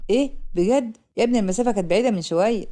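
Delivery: ordinary delivery, nothing marked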